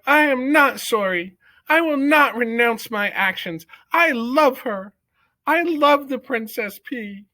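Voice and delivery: put-on young mouse declaring his love before the court; defiant declaration. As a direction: stand your ground proudly. Despero defies court.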